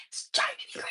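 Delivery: breathy whispered aside; whispering. Real, not acted. Aside about the movie.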